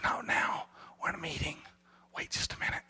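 whispered, hushing the parents away; hushed whisper. Acted. Disciples whisper to parents to stay back in a hushed tone. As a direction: breathe it out, don't voice it.

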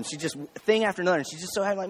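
ordinary talk, nothing marked

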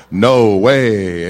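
loud, drawn-out put-on voice; mock manly cry. Injured lifter denying he screamed, loud put-on manly voice. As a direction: boom it out to replace the shriek. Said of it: "It was more like".

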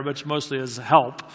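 usual speaking voice

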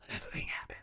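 breathy whisper of the repeated line; hushed repeat. Shown as a demonstration. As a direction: drop to a secret whisper.

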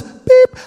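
a high electronic beep; mock Siri beep. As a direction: play the phone assistant's chirp. Siri's robotic reply voiced.

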